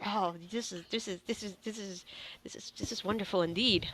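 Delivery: soft, stammering awe; quietly overwhelmed delight; hushed wonder. Acted. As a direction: marvel under your breath, repeating yourself. Awed scholar marvels at discovery.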